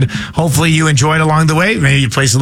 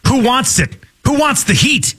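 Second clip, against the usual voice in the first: high, rising challenge to listeners; radio host calling for a trivia player; hyped call-out; 7 semitones higher than usual. Acted. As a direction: pump up the audience. Calling for a caller.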